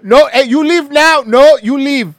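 loud, high mock Indian shopkeeper; Indian accent. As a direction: shout like an Indian store clerk in accent. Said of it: "sounds like an Indian guy that owns a seven eleven".

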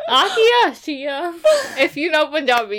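slow, high, laughing. Real, not acted. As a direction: laugh through the joke. Laughing joke in Punjabi.

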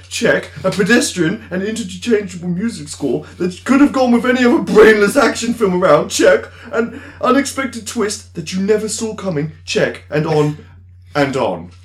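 loud, indignant upper-class rant; angry posh voice. Acted. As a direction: rant like an outraged toff critic. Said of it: "So angry posh voice".

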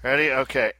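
ordinary talk, nothing marked

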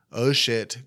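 normal talking voice

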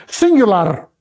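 singular with a flipped final R; singular in Spanish, seen-goo-LAR, R trilled. Shown as a demonstration. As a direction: flip the R closing singular. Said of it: "It's a flip on the R".

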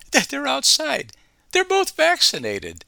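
high, exasperated mock outrage; incredulous high-pitched mockery; mock disbelief. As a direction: squeal it like you can't believe it. Mocking the Bidens' outdoor masking.

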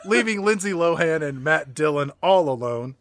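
ordinary delivery

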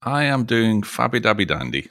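ordinary talk, nothing marked

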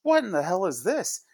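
high-pitched, acted indignant protest; put-on high voice of disbelief; Summer impression. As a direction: ask it high, as an appalled cartoon character. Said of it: "And even Summer goes".

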